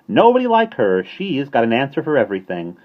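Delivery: high, loud cheery praise; perky starling voice; chirpy bird. A narrator's reading. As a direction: chirp it admiringly. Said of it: "he remarked chirpily".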